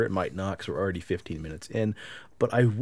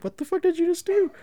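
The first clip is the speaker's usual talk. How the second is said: much higher, louder what the fuck; disbelieving cry; 5 dB louder than usual. Retold or imitated. Husband asking his wife what she just did, a high disbelieving cry. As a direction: yelp it in total disbelief.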